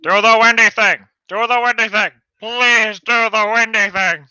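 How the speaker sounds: loud, high, desperate yelling; pleading shout. Acted. Character urging the hero to act, desperate shouting. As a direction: beg at full volume.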